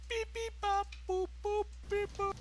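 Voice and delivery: high electronic beep-boop noises; dialing beeps. Acted. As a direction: beep like a dial tone. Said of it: "I'll just dial this in".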